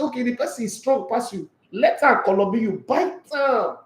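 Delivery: lightly voiced mother giving firm advice; mother coaching her child to fight back, mild mock sternness; imitating mom. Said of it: "My mom said".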